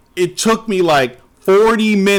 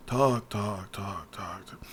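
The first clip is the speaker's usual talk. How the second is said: fading talk; 9 semitones lower, 13 dB quieter, slower than usual. Retold or imitated. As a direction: murmur dying to a whisper. Mocking endless dialogue, fading out.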